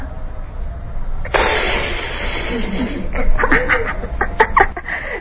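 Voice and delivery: high airy laugh trailing down; laughing at the punchline; breathy peal of laughter; real laughter. Real, not acted.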